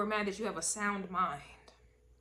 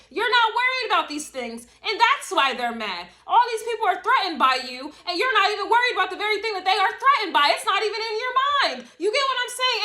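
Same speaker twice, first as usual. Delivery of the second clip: higher, louder, fast preaching rush; fired up; 10 semitones higher, 7 dB louder than usual. Real, not acted. Reader fires up viewers about their haters, loud excited preaching. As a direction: lift it up and preach it hard.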